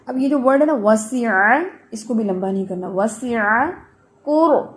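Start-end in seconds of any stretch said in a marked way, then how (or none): none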